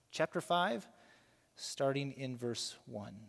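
usual speaking voice

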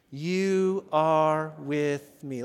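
drawn-out, word by word; each word held for the congregation to follow; slow call-and-response. Shown as a demonstration. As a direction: lead the repeat at a very slow, steady pace. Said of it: "Would you say it again".

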